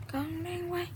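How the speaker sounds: calling to mom in Vietnamese; turned away, answering mom; turning away from phone. Real, not acted. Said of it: "that was my mom".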